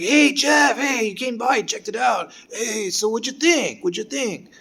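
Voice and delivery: high, eager friendly man; lightly voiced cheerful teacher; teacher's voice. Teacher Pepper voiced in story.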